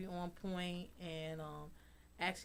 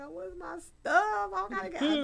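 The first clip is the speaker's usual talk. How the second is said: mock crying; 12 semitones higher, 6 dB louder than usual. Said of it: "I'm just crying like".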